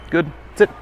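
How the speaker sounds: crisp, firm command to a dog. Real, not acted. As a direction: tell the dog to sit, firm and bright.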